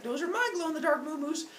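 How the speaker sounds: put-on high, possessive toddler; high-pitched baby talk. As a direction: claim them in a little-kid voice. Baby claims the cows.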